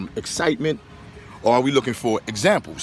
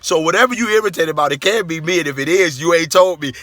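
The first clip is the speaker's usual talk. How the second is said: loud, higher-pitched comic indignation; indignant protest; 6 semitones higher, 5 dB louder than usual. Real, not acted. Brother protests to sister that he hasn't seen her all day, loudly indignant. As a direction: raise your voice in disbelief.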